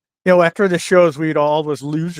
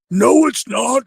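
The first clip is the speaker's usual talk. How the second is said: much higher, put-on gruff retort; a mock boast to the bartender; defiant refusal; 10 semitones higher, much slower than usual. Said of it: "Vader stands up and goes".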